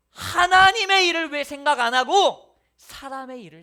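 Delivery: Jesus scolding Peter with a loud shout; rebuking shout. Acted. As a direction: thunder it at the disciple.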